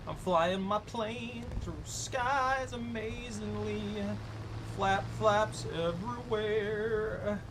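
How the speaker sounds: slow playful sing-song; sing-song narration. Pretend. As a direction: stretch it out grandly. Sing-song about flying plane.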